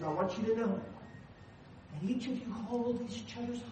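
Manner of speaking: wife quietly tells the engaged couple a loving truth; tender, soft-spoken. Retold or imitated. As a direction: speak it softly, like a blessing over the couple. Wife's tender words to couple.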